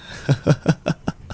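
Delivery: high airy giggle rising; a playful breathy laugh; light teasing giggle. Acted. Teasing partner.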